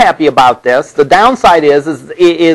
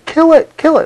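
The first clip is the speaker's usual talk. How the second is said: mock yelling; 14 semitones higher than usual. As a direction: mimic a crowd yelling, pitched way up. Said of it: "There's people yelling".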